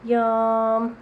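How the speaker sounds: usual speaking voice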